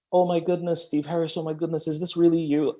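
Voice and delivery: high-pitched giddy fan squeal; freaking out fan. Retold or imitated. Starstruck follower gushes at the coach, high excited squeal. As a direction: gush like a starstruck follower getting a DM. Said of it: "like, freaking out".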